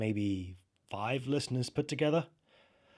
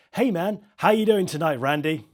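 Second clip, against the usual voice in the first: lightly voiced DJ, louder and higher; radio DJ greeting; 5 semitones higher, 8 dB louder than usual. Late-night DJ welcomes a regular trucker caller, radio DJ greeting.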